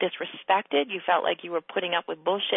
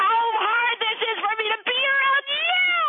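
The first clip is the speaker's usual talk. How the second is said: mock enlightened diva; 13 semitones higher, 4 dB louder than usual. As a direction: play a smug guru talking down to everyone. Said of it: "I just can't be bothered saying to everybody".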